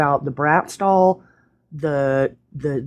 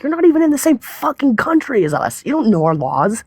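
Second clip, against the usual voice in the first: exasperated rant; 9 semitones higher than usual. Retold or imitated. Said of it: "it just pissed me off because I'm like".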